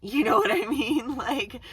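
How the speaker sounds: laughing through the question; amused laugh breaking into the question. Real, not acted. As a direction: giggle the words out loud. Laughs mid-rant about Aries.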